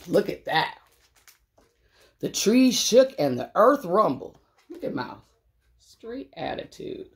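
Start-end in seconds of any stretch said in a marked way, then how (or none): 4.7-7.1 a quiet conspiratorial whisper; whispered aside. Real, not acted. Reading to kids, a whispered aside about Mouse. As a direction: whisper it, much softer.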